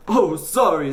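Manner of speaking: high, loud, jittery sorry; flustered apology. A narrator's reading. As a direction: apologise with nerves shot. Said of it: "trying to calm himself".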